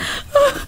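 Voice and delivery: high, unhinged gloating cackle; mad cackle. Acted. Employer gloating over fired assistant, mad cackle. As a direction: cackle like a man losing his mind. Donald gloats as Casey leaves.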